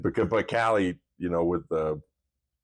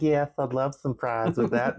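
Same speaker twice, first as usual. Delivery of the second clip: put-on much higher, slow Southern accent reply; Southern accent back; 8 semitones higher than usual. Dad answering the drive-thru worker in a put-on Southern accent. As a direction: answer her in your best Southern accent. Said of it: "I was like".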